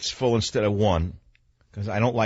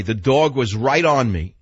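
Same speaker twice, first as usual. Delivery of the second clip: ordinary talk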